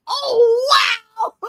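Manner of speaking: high, loud, drawn-out wow; amazed reaction. Retold or imitated. Host recalling getting surprise orders, amazed. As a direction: act out being floored by a delivery.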